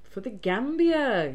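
"Gambia" in a slow, sing-song Welsh accent; drawn-out Welsh accent on "Gambia". Shown as a demonstration. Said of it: "did that in a Welsh accent".

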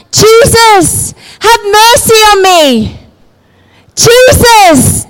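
beggar calling out high to Jesus, pleading. Retold or imitated. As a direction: cry out for mercy over the crowd.

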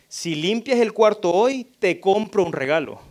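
mock parent offering a reward; bribing parent. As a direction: dangle the gift like a deal. Parent bribing child to clean.

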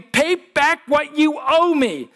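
a hint of a creditor leaning on a debtor; demanding. A narrator's reading. Servant demands his debt back with a mild mock threat. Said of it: "he demanded".

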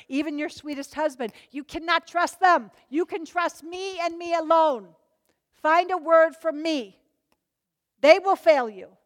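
ordinary delivery

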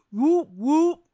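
high, loud whoop-whoop; exaggerated imitation of whooping; mock whooping. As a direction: whoop it out mockingly.

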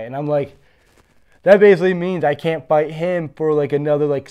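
normal talking voice

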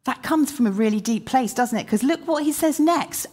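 ordinary talk, nothing marked

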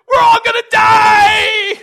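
acted all-out shriek of panic, drawn out; terrified scream. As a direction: scream it high and very loud, like a drowning sailor. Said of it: "They're terrified".

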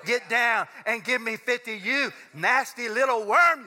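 loud barking drill sergeant; Major Payne. Retold or imitated. Pastor mimicking a movie drill sergeant for the congregation, a loud bark. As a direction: bark it like a drill instructor at a recruit. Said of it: "as sensitive as Major Payne".

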